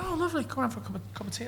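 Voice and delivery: high, soft and quick Jimmy voice; chirpy quoted invite; light impression of Jimmy. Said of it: "I said".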